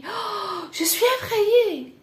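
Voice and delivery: high-pitched gasp, then frightened cry; acted fright. Shown as a demonstration. French teacher acting out being frightened, high and startled. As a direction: gasp and blurt it out in mock panic.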